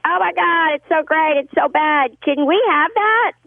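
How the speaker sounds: high, gushing fan voice; excited fans. Retold or imitated. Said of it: "the fans were like".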